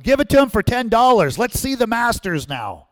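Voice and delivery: shouted heckle. Retold or imitated. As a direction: heckle the auctioneer to hurry up. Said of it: "another guy shouted out".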